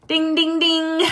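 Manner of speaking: high sing-out "ding, ding, ding"; boxing bell ding. Pretend. Host opens round one between Lisa and Whitney, imitated bell dings.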